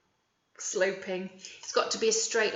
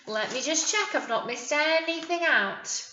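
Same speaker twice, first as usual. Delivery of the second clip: ordinary delivery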